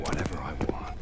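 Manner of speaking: quick gloating whisper. Acted. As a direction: hiss it out fast and low. Villain gloating over the old man.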